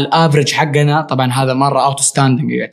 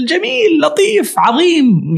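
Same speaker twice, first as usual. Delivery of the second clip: mock gushing; slower than usual. Gushing praise of commercial work.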